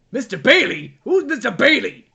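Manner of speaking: loud, hammed-up challenge from a bar customer; riled barfly. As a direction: call out the name like you want a fight. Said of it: "a random guy at the bar who goes".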